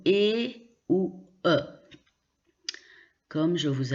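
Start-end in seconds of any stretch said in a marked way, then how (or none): 0.1-1.6 E ou E as two separate vowels, é or e; AI vowel sounds. Shown as a demonstration. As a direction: sound out E ou E as clear pure vowels. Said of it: "on prononce comme". (« E ou E » prononcés é ou e, bien séparés)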